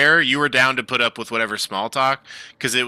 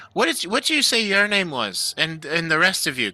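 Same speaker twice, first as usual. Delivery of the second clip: lightly raised halfling voice, chatty; proud halfling craftsman; 6 semitones higher than usual. Acted. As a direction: talk shop with a fan. Keegan asks names.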